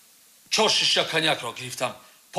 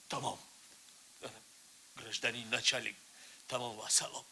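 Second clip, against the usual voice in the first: low, soft, resigned; defeated murmur; 6 semitones lower, 14 dB quieter than usual. Acted. Suspect finishing his confession to the investigator, spent and quiet. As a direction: let the words drain out of him.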